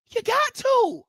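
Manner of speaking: "You got to" pushed up high, insistent; high-pitched emphatic repeat. Real, not acted. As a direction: leap way up on "got" to drive it home. Repeats phrase, second take pitched up.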